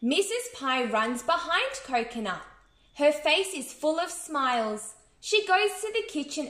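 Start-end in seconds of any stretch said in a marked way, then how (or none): none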